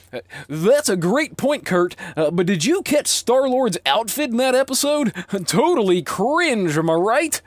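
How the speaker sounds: high, loud, hammed-up announcer banter; ridiculous radio announcer. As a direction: overblown radio host chatting up a guest. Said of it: "I talked to the guest that way".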